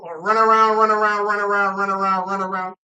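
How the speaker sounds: loud, droning run of commentary; mock soccer commentary. Mocking soccer's endless running, parody commentator. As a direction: chant it in one loud breathless string.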